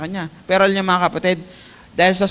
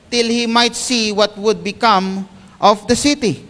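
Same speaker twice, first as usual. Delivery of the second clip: preaching at full force; 8 semitones higher, 4 dB louder than usual. Acted. Pastor reading Jonah chapter four to the brethren, loud preaching. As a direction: thunder God's question to the hall.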